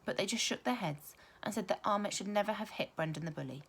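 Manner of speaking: ordinary delivery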